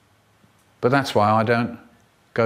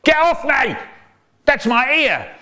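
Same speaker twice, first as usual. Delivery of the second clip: put-on child protest; 10 semitones higher than usual. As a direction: yelp it as if your ear is pulled.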